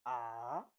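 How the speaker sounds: Mandarin third tone on a long AH, falling then climbing. Shown as a demonstration. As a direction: drop AH down and swing it back up. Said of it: "You fall and rise".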